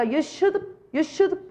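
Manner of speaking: high-pitched "you should", "should" as one short syllable; reduced "should have". Shown as a demonstration. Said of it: "이렇게 들립니다".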